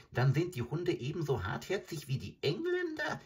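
high, quick, complaining jackal voice; a hungry jackal whining about his luck; high whiny jackal. Acted.

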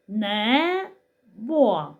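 небо slowly, as не, pause, бо; split into syllables. Shown as a demonstration. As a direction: stretch both vowels of небо apart. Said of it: "поділити слово небо на склади".

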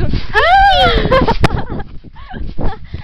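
loud delighted laughing shout. Real, not acted. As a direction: burst out laughing loudly. Bouncing high on a trampoline.

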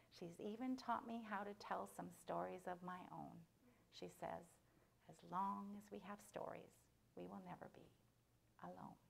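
very soft, with long pauses; hushed story ending. A narrator's reading. Storyteller closing the mouse's tale, hushed and gentle. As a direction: let it sink gently to near whisper.

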